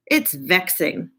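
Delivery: usual speaking voice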